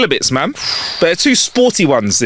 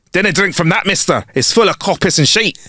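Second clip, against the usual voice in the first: higher-pitched mock Scottish call; comic stage-Scottish voice; horrible Scottish accent; 5 semitones higher than usual. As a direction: warn them in a put-on Scottish accent. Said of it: "for a horrible accent".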